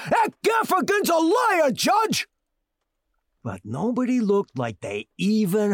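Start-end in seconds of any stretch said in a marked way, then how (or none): 0.1-2.3 high, loud shout to the judge; shouting the cop down before the judge; hollering. Acted. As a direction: holler it across the courtroom. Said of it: "I hollered out again".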